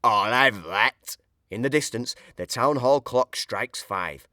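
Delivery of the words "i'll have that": slow, lightly voiced burglar claiming the box; a hint of a greedy thief; Burglar Bill voice. A narrator's reading. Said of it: "says Burglar Bill".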